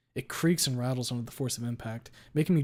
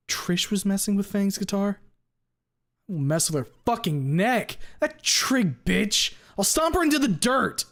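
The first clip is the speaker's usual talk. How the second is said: higher, louder furious yelling; furious shout; 8 semitones higher, 7 dB louder than usual. Acted. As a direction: explode with rage. Raging outburst about Trish.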